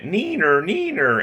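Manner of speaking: high, loud singsong taunt; mocking neener. Retelling Alex's Hillary bit, high mocking taunt. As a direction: tease it in a squeaky high pitch. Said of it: "just the way he did it".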